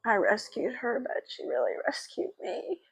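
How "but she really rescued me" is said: tearful whisper. Real, not acted. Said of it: "I've been crying".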